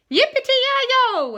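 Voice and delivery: high, loud, slow cheer; hollering. Acted. The townsfolk cheer for their hero, high and loud. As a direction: whoop it out with joy. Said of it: "everyone hollered".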